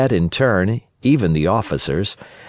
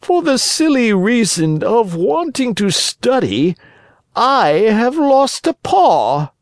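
woeful sly fox; 14 semitones higher, 4 dB louder than usual. Acted. Fox's voice in the story.

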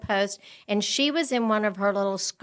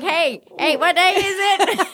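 put-on confused old folks; 9 semitones higher than usual. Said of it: "We're like".

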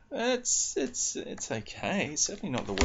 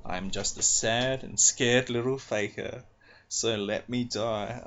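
poem reading; 3 dB louder than usual. Acted.

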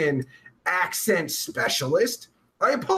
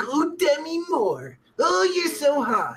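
mock swooning; 8 semitones higher than usual. Host recalling his crush on Demi Moore, mock swooning. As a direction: gush like a smitten fan.

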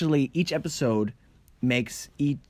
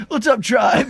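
loud; 9 semitones higher, 6 dB louder, slower than usual. Pretend. Mock show sign-off greeting.

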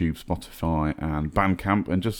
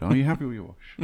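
Eddie Izzard quote; 6 semitones higher than usual. Retold or imitated. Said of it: "Eddie Izzard reference there".

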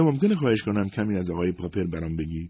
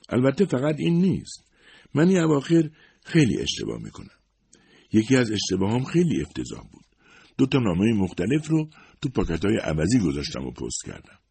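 a higher, gentle secretary voice; lightly voiced Ms Barton; 6 semitones higher, 4 dB louder than usual. A narrator's reading. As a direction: play the loyal older secretary, modest and kind. (صدای کمی زیرتر خانم بارتون، منشی مهربان)

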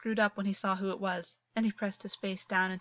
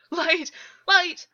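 frantic high shouts of terror; screaming for light in a nightmare; panicked cry; 9 semitones higher, 10 dB louder than usual. A narrator's reading. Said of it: "August cried out".

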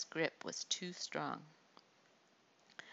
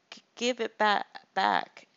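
stuttering plea; 5 semitones higher, 10 dB louder than usual. A narrator's reading. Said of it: "Amiz whispered".

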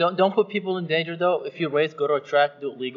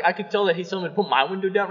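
ordinary delivery

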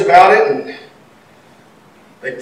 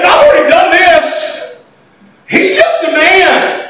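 confident shout; 7 semitones higher than usual. Acted. David confident, preached.

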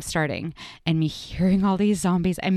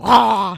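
mock zombie roar; 7 dB louder than usual. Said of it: "they go".

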